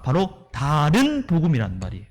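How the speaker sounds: usual speaking voice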